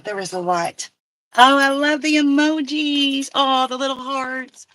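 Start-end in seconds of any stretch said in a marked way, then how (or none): 1.4-4.7 louder, higher and drawn-out delight; delighted gushing. Real, not acted. Rider gushing over cute emojis in messages, delighted. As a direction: gush slowly over something adorable.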